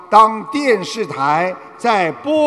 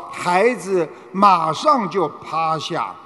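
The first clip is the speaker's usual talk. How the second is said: normal talking voice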